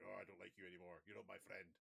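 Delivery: put-on a quick jokey Scottish accent, lightly done. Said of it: "I did a Scottish accent for an Irish person".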